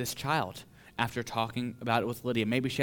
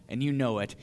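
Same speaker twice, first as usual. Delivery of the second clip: usual speaking voice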